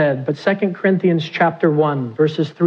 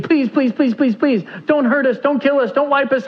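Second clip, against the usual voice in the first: desperate begging; 9 semitones higher than usual. Acted. Brothers face down, begging Joseph.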